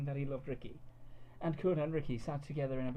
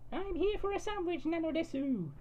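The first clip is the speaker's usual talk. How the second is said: wide-eyed cartoon girl, pitched way up; cutesy anime girl. A narrator's reading. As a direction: girlfriend amazed, in a high squeaky anime voice. Cud voiced in story.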